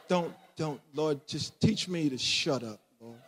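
low, soft muttered prayer; retold self-restraining plea to God; restrained prayer. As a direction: hold yourself back, praying under your breath. Retelling his prayer during marital arguments.

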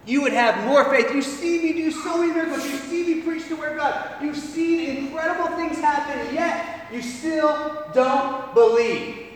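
loud, frustrated scolding; Jesus scolding disciples for their unbelief; exasperated rebuke. Acted. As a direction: scold them for their lack of faith. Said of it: "He's saying".

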